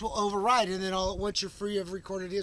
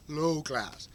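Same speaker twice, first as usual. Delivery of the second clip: ordinary talk, nothing marked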